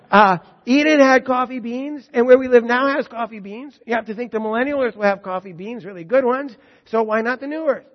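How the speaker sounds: loud, high, ringing sermon voice; full-force preaching. Acted. Preacher proclaiming scripture to the congregation, full force. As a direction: proclaim it to the whole hall.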